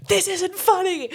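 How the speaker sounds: high slow mock indignation; high mock-stern voice. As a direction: squeak it out, offended. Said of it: "He was like".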